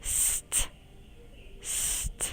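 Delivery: breath-only S, then t; whispered final sounds. Shown as a demonstration. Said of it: "Các em ghép lại nào".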